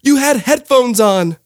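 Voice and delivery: high, slow teacher voice; drawn-out, patronizing teacher; mock prim teacher; teacher reply. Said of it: "And she said".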